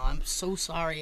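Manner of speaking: low, soft, discreet apology; confiding Roger Moore. Retold or imitated. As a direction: apologise under cover, voice dropped. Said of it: "he kinda leans in and he goes".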